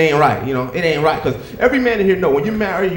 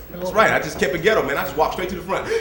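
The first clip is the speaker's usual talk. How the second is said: ordinary talk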